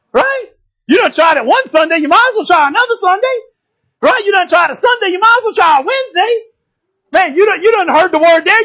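high, loud preacher's exhortation; preaching at full force. Acted. Preacher urging the congregation to keep coming, full-force preaching. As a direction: drive it home, rising with each line.

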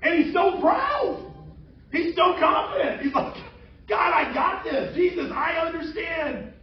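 loud, high, boastful Peter; proud boast. Retold or imitated. Preacher voicing Peter boasting to Jesus, proud and loud. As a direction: shout it out like a cocky disciple.

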